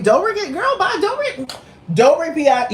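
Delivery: high, laughing diva dismissal; mocking falsetto. Sneering at Dobrik's fame, in a shrieking falsetto. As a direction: squeal his name way up high.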